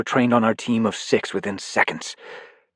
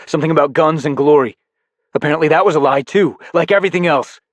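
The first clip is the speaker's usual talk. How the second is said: higher, louder, faster mockery; bitter anger; 8 semitones higher, 6 dB louder, faster than usual. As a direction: throw his words back. Narrator mocks Briggon.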